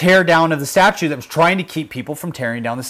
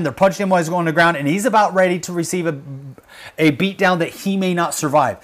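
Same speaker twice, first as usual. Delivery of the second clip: usual speaking voice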